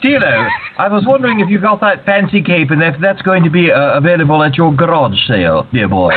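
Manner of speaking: put-on high Australian accent, dear boy; hammed-up Australian caller. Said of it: "my terrible Australian accent".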